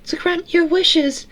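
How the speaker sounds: very high, tinny falsetto; Eleanor girl voice. A narrator's reading. Eleanor offering to grant Sarah's wishes, tinny little voice.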